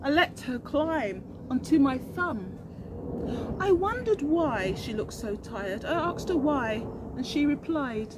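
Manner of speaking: put-on slow, gentle read-aloud for children; storybook narration. A narrator's reading. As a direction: read it like a bedtime rhyme.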